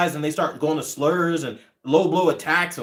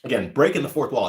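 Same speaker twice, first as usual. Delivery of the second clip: ordinary talk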